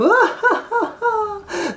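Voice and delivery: squealing laugh; high merry laughter. Acted. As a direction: laugh till you can't stand. Everyone laughs.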